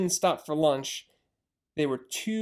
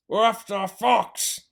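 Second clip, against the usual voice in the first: gruff farmer answer; 4 semitones higher, 4 dB louder than usual. Acted.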